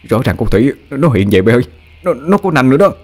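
stammering, frightened insistence; trembling fright. A narrator's reading. An warns his friends he saw fangs, frightened and stammering. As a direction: insist in panic, tripping over words. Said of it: "Nó ngơ ngẩn run run".

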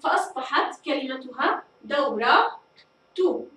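دورة ending in a clear تو; taa marbuta voiced. Shown as a demonstration. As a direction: lift the final تو of دورة. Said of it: "نطقت التاء المربوطة بسبب حركة الضمة". (إظهار التاء المضمومة في دورة تو)

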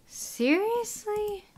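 very high, slow drawn-out "Seriously?"; disbelieving squeal; stunned disbelief. Real, not acted. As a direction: stretch it up in shock. Shocked at migrant numbers.

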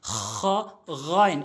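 "খ" and "গইন" scraped at the top of the throat; throat-fricative "খ", then voiced "গইন"; খ, গইন demonstration. Shown as a demonstration. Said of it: "এভাবে আমরা উচ্চারণ করব". ("খ", "গইন", হলকের শেষ থেকে)

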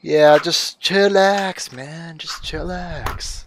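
put-on hippie drawl, fading away. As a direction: drag it out like a laid-back hippie. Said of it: "put on my hippie voice".

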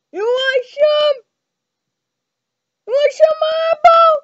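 high, loud mock taunt; taunting shout. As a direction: challenge them, loud. Taunting the eyeball.

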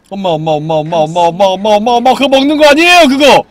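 building to a high loud yell; panicked rising shouts of mom; frantic pleading. Real, not acted. Begging mom to stop eating.

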